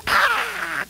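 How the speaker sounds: high startled cry; shocked shriek. Acted. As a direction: shriek in mock horror.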